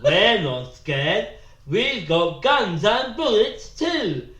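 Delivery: loud, slow, tongue wedged behind the lip. Shown as a demonstration. Showing the lion hunt routine, tongue-in-lip voice. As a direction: talk with your tongue stuffed down between teeth and lip. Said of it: "You stick your tongue down the".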